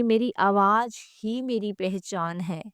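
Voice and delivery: ordinary delivery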